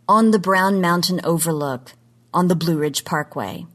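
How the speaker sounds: normal talking voice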